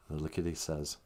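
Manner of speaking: ordinary delivery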